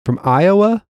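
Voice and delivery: mock the big O in "Iowa", swooping up; mimicked stress on Iowa. Teasing the co-host's pronunciation, exaggerated stress on Iowa. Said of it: "You put a lot of emphasis on".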